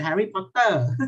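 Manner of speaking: Thai-accented Harry Potter, stretched final syllable on "Potter"; Thai pronunciation. Shown as a demonstration. Teacher showing the class the Thai way, Thai accent. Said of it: "In Thai we say".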